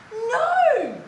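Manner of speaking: high, loud storybook "no"; loud protest. A narrator's reading. Basil objecting to cutting the vine, loud protest. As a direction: cry out in dramatic objection.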